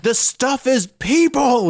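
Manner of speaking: high, slow, hammed-up wail of horror; mock movie despair. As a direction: ham up a doomed movie hero crying out. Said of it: "He's just like".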